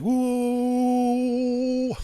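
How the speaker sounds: high, drawn-out imitated crowd "ooh"; crowd ooh imitation. Telling a mate about the Millwall crowd, high imitated ooh. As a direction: mimic the whole stand going ooh. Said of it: "that noise that make".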